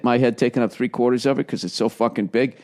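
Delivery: ordinary talk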